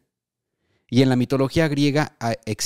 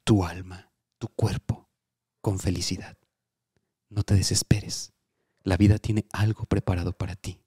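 soft tender message; 4 semitones lower, 4 dB quieter than usual. Pretend. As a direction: soothe her like an oracle.

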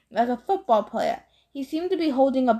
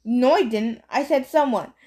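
plain reading voice

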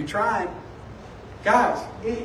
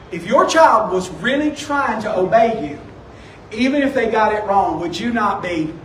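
normal talking voice

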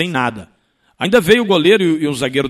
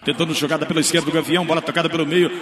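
ordinary talk

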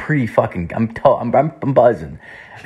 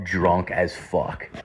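ordinary talk

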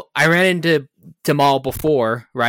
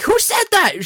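calling out. Retold or imitated. Quoting Barbara calling out.

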